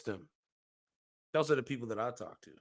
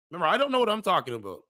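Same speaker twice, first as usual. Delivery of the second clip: sarcastic jab; 6 semitones higher, 6 dB louder, faster than usual. Acted. Mocking critics' claim.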